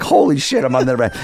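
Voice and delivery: high-pitched, lightly acted panic; retold freak-out. Man hiding naked under the bed, mock panic. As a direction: blurt it as if about to be caught. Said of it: "I'm, like, freaking out".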